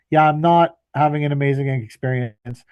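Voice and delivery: ordinary talk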